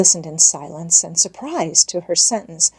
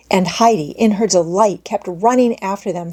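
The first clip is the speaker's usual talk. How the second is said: plain reading voice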